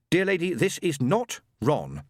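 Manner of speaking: usual speaking voice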